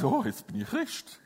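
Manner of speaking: lightly voiced offhand waking thought; mock casual. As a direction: toss it off casually. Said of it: "Niemand ist heute Morgen aufgewacht".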